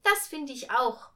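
high, loud emphatic agreement; girl calling out. A narrator's reading. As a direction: call your agreement up to someone. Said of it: "rief sie".

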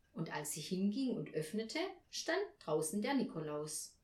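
ordinary delivery, nothing marked

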